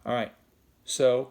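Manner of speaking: ordinary delivery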